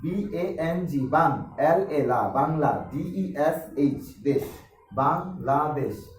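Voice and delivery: slow letters in groups, each followed by its sound, বাং, লা, দেশ; syllable-by-syllable spelling. Shown as a demonstration. Teacher shows another way pupils read Bangladesh, chunk by chunk. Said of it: "আবার অনেকে পড়ে".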